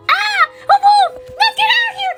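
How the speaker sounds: high, frightened pretend yell at the wolf; scared shout. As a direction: shriek like a wolf is coming, playfully. Pretend wolf appears, kids flee.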